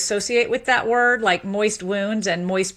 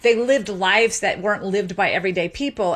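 ordinary talk

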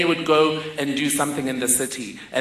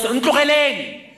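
acted yell; 8 semitones higher than usual. Mother yells from the window at a parked car, high acted yell. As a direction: cry out to scare off a stranger. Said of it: "and yells".

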